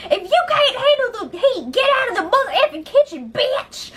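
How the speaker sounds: high mock rant, rushed in the middle; hammed-up fury while pounding the counter; imitated screaming rant. Raven screaming at Jess, hammed-up high rant. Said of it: "Raven is screaming".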